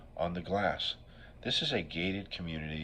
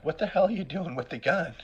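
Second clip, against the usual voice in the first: put-on loud, high shout as his old Italian mom; much higher, louder mock mom yell; 11 semitones higher, 8 dB louder than usual. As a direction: shriek at him like an alarmed mother. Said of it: "She's like".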